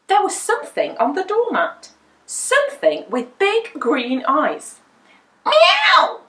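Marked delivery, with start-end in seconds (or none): 5.4-6.2 high, loud yowling meow; a startled cat yowl; cat cry. A narrator's reading. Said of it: "cried Wilbur".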